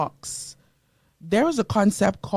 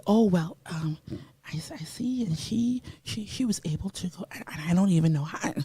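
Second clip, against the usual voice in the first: soft, hesitant jealous mumbling; jealous mumble; 7 dB quieter than usual. Retold or imitated. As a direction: mutter it unsure and envious. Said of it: "And you say".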